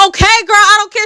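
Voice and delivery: exasperated. Retold or imitated. Speaker fed up with Kamari's riddles, exasperated and loud. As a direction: snap that you don't care.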